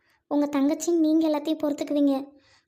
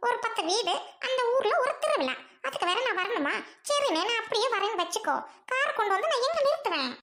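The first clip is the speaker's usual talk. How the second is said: higher, scornful laughing sneer; mocking laugh; 8 semitones higher, 4 dB quieter than usual. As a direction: laugh down at the little village festival. Sister mocks village festival.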